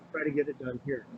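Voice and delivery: ordinary talk, nothing marked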